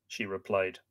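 plain reading voice

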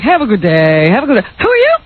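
voice going way up. Retold or imitated. Co-host mimics the lawyer's brush-off, voice going way up. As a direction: imitate him, jumping way up at the end. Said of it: "his voice goes way up like that".

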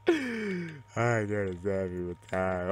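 very slow, stretched-out mock wail; mock lament. Gamer to fallen teammate, slow mock lament.

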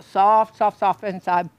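talking with a mouthful of bread; chewing while speaking; mouth full. Real, not acted. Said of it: "I'm talking with my mouth full".

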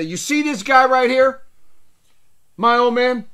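loud, high showing off his dad; mock proud boast. Boasting to the uncles at a party, loud and high. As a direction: proudly present the old man to the family. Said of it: "I'll say".

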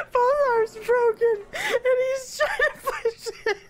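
high, squeaky words through real giggles; laughing to tears. Real, not acted. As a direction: talk while helplessly cracking up. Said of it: "I'm so glad you guys can't see me".

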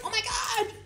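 high whiny tattletale voice; whiny tattling sibling. Retold or imitated. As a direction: whine it like a kid telling on a brother. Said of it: "it's like".

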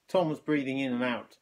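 ordinary delivery

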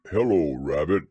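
lightly voiced low bear greeting; Pooh. A narrator's reading. Bear greets his friend, low and hearty.

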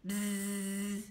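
long buzzing bee noise. Acted. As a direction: buzz like a bee. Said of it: "We have the bee".

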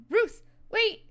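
loud high shout after her; calling out. Acted. Player calling after Ruth, loud shout. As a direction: call her back before she leaves.

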